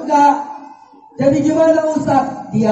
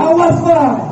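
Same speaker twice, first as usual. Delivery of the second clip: sharper refusal; 8 semitones higher, 3 dB louder than usual. Acted. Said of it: "Ditunjuknya muka suaminya".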